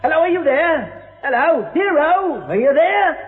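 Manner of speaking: high sing-song calling out; mocking call-out. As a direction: call into the empty room, high.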